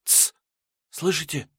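put-on hushed, startled girl; frightened hush. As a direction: shush them like a startled girl. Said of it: "Снова вздрогнула дева".

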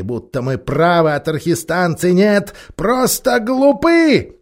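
high, slow hammering proclamation; bullying ape voice. Acted. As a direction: drive the lie home.

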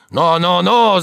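loud, climbing cries; thundering warning. A narrator's reading. Servant threatens the maid with his elbow, thundering. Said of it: "загремел он".

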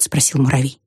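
ordinary delivery, nothing marked

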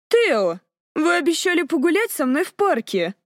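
put-on high, pouting young lady; capricious. A narrator's reading. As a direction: whine it like a spoiled lady. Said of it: "капризно сказала Кассандра".

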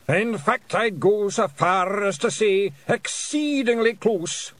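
high, slow, declamatory Scots; William. A narrator's reading. Bard expanding his verdict as whale closes, drawn-out Scots. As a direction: stretch out the grand pronouncement.

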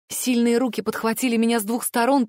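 ordinary delivery, nothing marked

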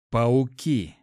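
a touch of a hiss, firmly voiced. A narrator's reading. Hero realizes what attacked the hyena, hissed. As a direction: hiss out the grim discovery, mildly. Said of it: "Прошипел".

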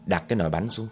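plain reading voice

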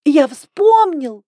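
high, loud, slow squeaky cry; squeaky shout. A narrator's reading. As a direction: squeal it out like a rubbery toy. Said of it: "писклявым голосом крикнул".

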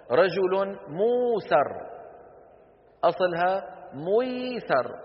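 موسر and ميسر said very slowly; drawn-out example. Shown as a demonstration. Teacher slowly sounds out example words for students. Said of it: "تقلب واو".

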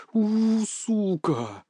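resentful, stretched-out cursing; seething curse. A narrator's reading. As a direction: groan the swear word slowly. Soaked fox curses resentfully.

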